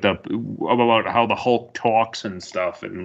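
usual speaking voice

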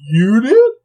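high, loud disbelieving question; surprised disbelief. Retold or imitated. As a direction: shoot it up in disbelief. Coach surprised at scorer.

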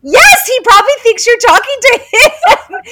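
high, laughing exclamation; excited agreement. Real, not acted. Friend agrees about what the dog thinks, excited. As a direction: burst out with delighted agreement.

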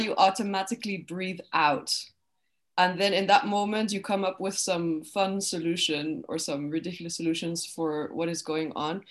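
ordinary talk, nothing marked

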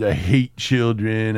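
ordinary talk, nothing marked

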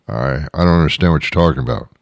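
reply fast and low, confused; ordinary talker. Acted. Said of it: "talk like this".

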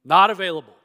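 loud, 'Not' punched hard and falling; forceful repeat. Real, not acted. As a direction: slam the door on the argument. Repeats Paul's answer forcefully.

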